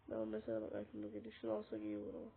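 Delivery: whispered aside. Real, not acted. Whispered aside during sneaky play.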